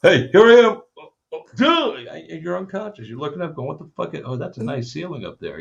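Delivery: high, then dropping to a dazed murmur; mock dazed fighter. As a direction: play a knocked-out fighter waking up. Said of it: "mocking a knocked-out opponent".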